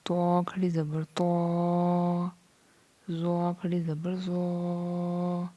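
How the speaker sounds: slow, stretched lesson chant; Qaida recitation. Shown as a demonstration. Teacher drilling letters for students, slow chant. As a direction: lengthen each long vowel.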